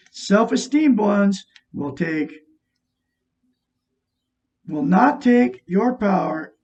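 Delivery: slow, deliberate emphasis on each word; slowed-down preacher emphasis; slow emphatic. Shown as a demonstration. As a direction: lean hard on the point, slowly. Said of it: "repeats the line for emphasis".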